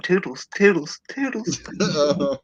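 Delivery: high-pitched, chirped imitation of a wrestler; mock cutesy farewell. Host mimicking a wrestler's catchphrase goodbye, very high mock voice. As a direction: trill it sky-high, mocking her sign-off. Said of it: "she was just going".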